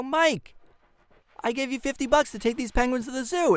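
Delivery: high, loud mock outrage at Mike; exasperated yell. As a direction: shout it like you can't believe your eyes. Yelling at Mike about penguins.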